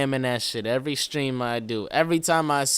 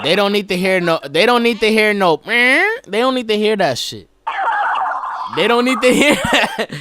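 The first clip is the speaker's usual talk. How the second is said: loud laughing rant; 7 semitones higher, 7 dB louder than usual. Real, not acted. Excited loud ranting.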